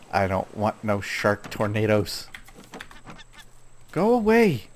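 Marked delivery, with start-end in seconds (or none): 3.9-4.6 higher, louder shout to shoo it off. Real, not acted. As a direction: drive the shark away. Shooing the flying shark.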